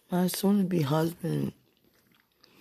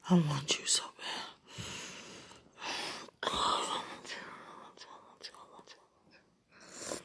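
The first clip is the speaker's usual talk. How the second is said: longing whisper; 12 dB quieter than usual. Real, not acted. Telling a lover of desire, in a fading whisper. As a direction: whisper that fades to almost nothing. Intimate message to absent lover.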